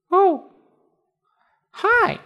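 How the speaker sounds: very high tiny cricket voice. Acted. Cricket greets tortoise.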